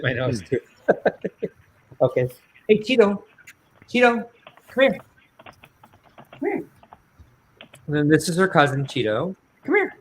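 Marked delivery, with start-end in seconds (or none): 2.7-6.6 high-pitched call to a puppy; short, high dog-calling. Real, not acted. 9.6-9.9 very high little call to a puppy; short high-pitched beckoning; calling a dog. Real, not acted.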